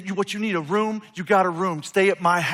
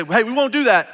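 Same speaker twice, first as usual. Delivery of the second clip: "we won't do that" louder, higher, hitting "won't"; blunt defiant refusal; 7 semitones higher, 10 dB louder than usual. Shown as a demonstration. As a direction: snap back a flat no at the king. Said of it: "they don't say it like that".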